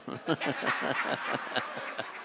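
long, breathy chuckle; long laugh. Real, not acted. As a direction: keep chuckling softly after your joke. Laughs after his Obamacare joke.

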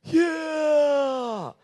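long high falling cry of triumph; mock cheer. Preacher acting the crowd cheering the revenge, long high whoop. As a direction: cheer like a crowd gone wild.

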